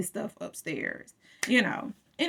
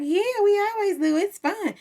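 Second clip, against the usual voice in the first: 1 high mock voice; 10 semitones higher than usual. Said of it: "He was like".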